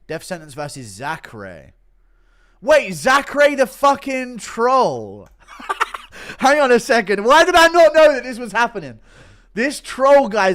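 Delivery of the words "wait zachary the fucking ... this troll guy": loud, high, stunned excitement; can't believe it, shout it; excited disbelief. Real, not acted. Shocked at fight news.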